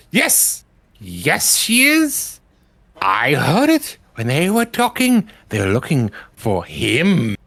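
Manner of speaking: a high imp scribe blurting to its mistress. Acted. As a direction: squeak it out like a tiny demon tattling. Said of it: "the little imp scribe perched on her shoulder, um, says".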